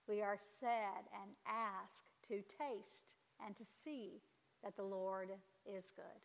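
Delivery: ordinary talk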